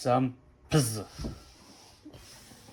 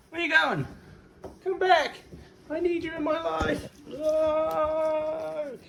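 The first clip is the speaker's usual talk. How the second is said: very high-pitched, hammed-up pleading cry; mock heartbroken wail; slower than usual. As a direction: wail melodramatically after someone leaving. Mock farewell to departing pet.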